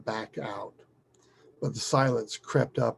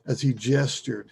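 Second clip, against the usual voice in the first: ordinary delivery, nothing marked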